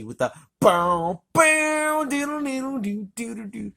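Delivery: scat the synth melody up high; synth riff imitation. Reviewer mimics the triumphant synth for viewers, high scat.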